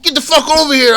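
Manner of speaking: loud, high, forceful order; imitated guard's shout. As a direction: yell it as a guard grabbing a runaway. Retold guard grabs the teller.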